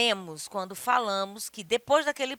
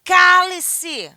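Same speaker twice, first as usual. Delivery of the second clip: loud, high shut-up command; forceful raised command; stern order; 9 semitones higher, 13 dB louder than usual. Shown as a demonstration. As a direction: order silence at full force. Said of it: "ou ordem".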